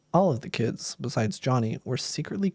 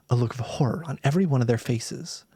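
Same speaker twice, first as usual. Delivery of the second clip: usual speaking voice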